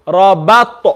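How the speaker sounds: Rabat with smiled ba and echoing t; smiley ba. Shown as a demonstration. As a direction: carefully model Rabat, thin ba, bounced t. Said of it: "concentrate on this part".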